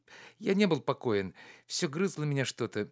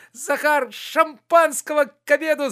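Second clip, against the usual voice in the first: much higher and louder, exuberant call; shouting; 10 dB louder than usual. A narrator's reading. Oblomov calling Zakhar for champagne, joyful shout. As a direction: shout for the servant in celebration. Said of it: "закричал он".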